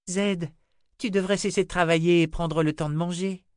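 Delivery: put-on high-pitched little girl voice; little girl Rachel. Little girl urges the old wizard to eat, high child voice.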